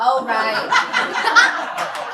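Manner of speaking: laughing it off; sheepish laugh. Real, not acted. Laughs at own misreading.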